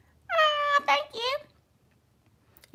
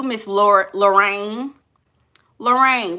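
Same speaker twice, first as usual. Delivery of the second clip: ordinary talk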